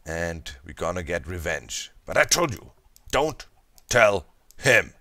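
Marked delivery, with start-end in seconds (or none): 2.0-4.9 put-on a stern German-accented warning, leaning on the final word; half-serious strict German warning. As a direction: scold the viewers like a strict German teacher. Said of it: "That's my strictest German voice".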